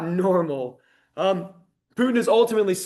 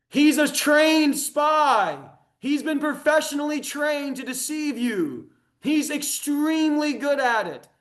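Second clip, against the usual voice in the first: urgent warning; 7 semitones higher, slower than usual. Real, not acted. Warning audience about Putin.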